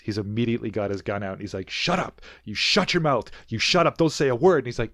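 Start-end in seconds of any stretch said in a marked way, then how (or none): 1.7-4.6 raised, higher voice cutting someone off; mock gunpoint command, loud and emphatic; retold angry shouting. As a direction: urgently order him silent, acted out. Mulder at gunpoint silencing a suspect.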